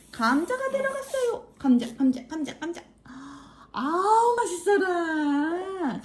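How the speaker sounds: high, playful feeding chant. Real, not acted. Feeding baby, high sing-song.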